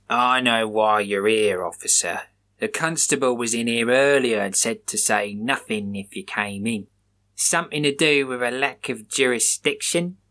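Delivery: a lightly voiced wary shop worker.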